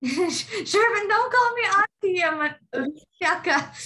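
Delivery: laughing, high and loud: Shervin, don't call me auntie; laughing protest. Real, not acted. Student calls teacher auntie.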